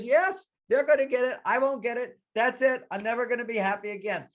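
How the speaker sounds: put-on very high, despairing child voice; hammed-up childish despair, high and urgent; scared little girl. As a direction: imitate a distraught kid wailing. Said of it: "you're scared and afraid".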